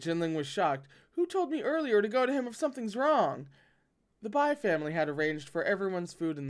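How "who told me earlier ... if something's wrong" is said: indignant. Acted. Jinling retorts indignantly.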